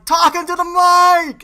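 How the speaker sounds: loud, high, drawn-out heckle; slow crowd shout, acted out at full volume; imitating yelling crowd. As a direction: yell it like an exasperated audience. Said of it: "everyone in the crowd is yelling".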